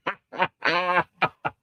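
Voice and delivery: high-pitched burst of laughter; laughing. Real, not acted. Reacting to a comment about his voice.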